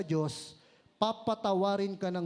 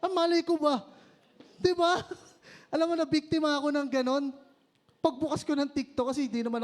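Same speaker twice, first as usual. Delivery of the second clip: high, loud and laughing; excited; 7 semitones higher, 4 dB louder than usual. Real, not acted. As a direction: caught out and laughing about it. Excited retelling, caught on TikTok.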